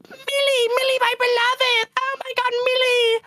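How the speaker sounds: high whiny lovesick imp voice; Moxie's whiny voice. Retold or imitated. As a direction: swoon in a squeaky, pitched-way-up whine. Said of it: "the horny dude with the whiny voice".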